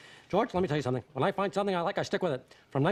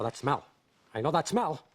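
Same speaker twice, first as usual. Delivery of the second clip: ordinary delivery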